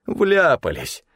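high groan of dismay. A narrator's reading. Stefan sees the murdered inspector, groaning in dismay. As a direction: moan it out, falling from a high pitch. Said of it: "простонал Стефан".

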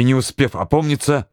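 plain reading voice

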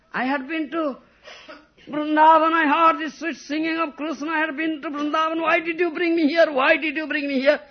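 high, loud tearful wail; crying plea. Acted. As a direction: weep out the question in longing. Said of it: "Then started crying".

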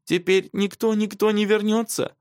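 put-on high, forlorn child's question; plaintive, high-pitched pleading; dying voice. A narrator's reading. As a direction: ask it as a despairing little girl. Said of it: "умирающим голосом спросила она".

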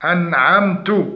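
أنعمت said slowly ending in "tu" instead of "ta"; wrong u-vowel. Shown as a demonstration. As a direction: end أنعمت with a damma on the ta. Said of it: "ضم التاء وهو لحن جلي". (ضم التاء خطأ)

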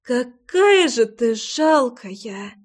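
slow, groaning words through pain; pained moan. A narrator's reading. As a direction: drag it out like a hurt moan. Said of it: "простонала Лой".

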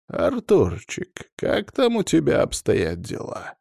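unhurried, inquisitive lilt; quiet, inquiring. A narrator's reading. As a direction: draw out the pet name as a menacing-sweet question. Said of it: "тихим, интересующимся голосом спросил".

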